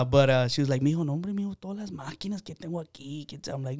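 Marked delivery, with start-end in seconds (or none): 0.8-3.5 much softer, lightly voiced grandma fussing; a hint of grandma, soft Spanish grumble; grandma's soft complaint. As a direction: murmur it like an old abuela. Grandma complains about hospital machines.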